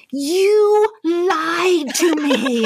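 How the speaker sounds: high, long-drawn mock scream; retold angry scream. Wife phones husband after being locked out, retold angry scream. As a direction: stretch each word out in a retold scream. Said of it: "I just screamed it".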